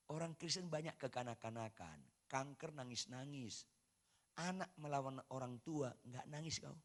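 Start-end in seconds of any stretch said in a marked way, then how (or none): none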